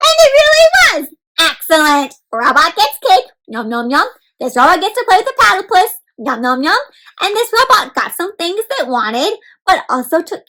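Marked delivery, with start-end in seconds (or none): 0.1-1.1 much higher, louder and thrilled; excited exclaim. A narrator's reading. 3.5-4.1 eating noises. Acted. Robot eats cake. 6.2-6.8 eating noises. Acted. Robot eats cake again.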